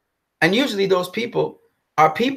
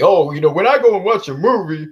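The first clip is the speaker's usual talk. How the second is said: lightly mimicked fan voice, a touch louder; mock the fans' earnest tone; mocking imitation; 3 semitones higher, 4 dB louder than usual. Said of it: "I see motherfuckers trying to imitate me".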